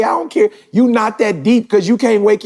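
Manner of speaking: shoots way up in pitch on I don't care; fired up, brushing it all aside; emphatic. Real, not acted. Dismissing wealth and titles.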